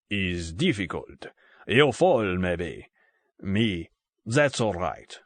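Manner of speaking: put-on halting, Russian-accented broken English; thick Russian accent. A narrator's reading. Russian gunman explains the climb to Bond, thick Russian accent. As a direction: reason it out phrase by phrase, heavily Russian-accented. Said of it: "in a thick Russian accent".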